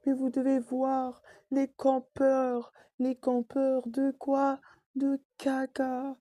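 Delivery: high, slow whining complaint; whiny annoyance. Real, not acted. Annoyed gamer complains to viewers about campers in a high, slow whine. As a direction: drag the words out in a high, sulky whine. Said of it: "Ça m'énerve".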